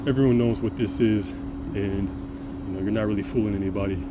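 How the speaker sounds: ordinary talk, nothing marked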